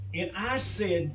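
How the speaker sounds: ordinary talk, nothing marked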